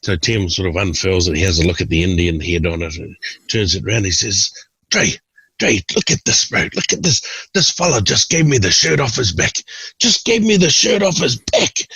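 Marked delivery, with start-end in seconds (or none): 4.9-11.9 much higher, excited show-off; hammed-up delighted Tim. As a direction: call your mate over to admire the gift. Tim excitedly showing shirt.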